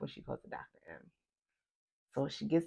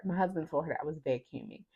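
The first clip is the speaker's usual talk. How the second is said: ordinary talk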